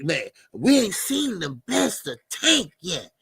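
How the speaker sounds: usual speaking voice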